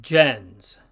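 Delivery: gens as "jenz"; soft G, final Z. Shown as a demonstration. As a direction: say gens with G as in giant and S as Z. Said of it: "Notice also the soft G".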